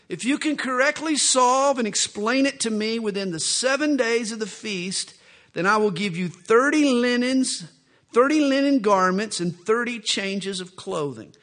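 a lightly voiced cocky Samson, proud of his riddle; Samson's wager.

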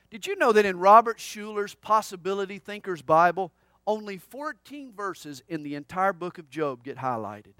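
ordinary talk

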